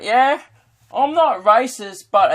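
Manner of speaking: high, slow, loud Aussie drawl; exaggerated high-pitched Australian voice; caricature Australian. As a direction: ham up an Australian bigot's opener. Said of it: "nothing more Australian than just".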